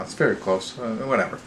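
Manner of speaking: normal talking voice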